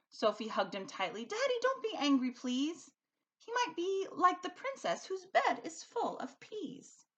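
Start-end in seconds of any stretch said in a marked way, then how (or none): 1.2-7.0 put-on pleading little girl. A narrator's reading. Sophie pleading with father.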